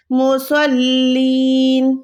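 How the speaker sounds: slow chanted ویلا یحز with a guttural ha; Quran recitation. Shown as a demonstration. Tutor reciting the verse for her student: slow tajweed chant. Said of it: "لام کے ساتھ ملانا ہے".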